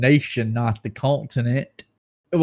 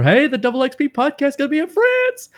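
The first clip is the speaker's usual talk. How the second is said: high put-on voice, much higher; high-pitched mock invitation; mock announcer; 4 dB louder than usual. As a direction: squeal it like an excited invite. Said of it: "somebody will send us".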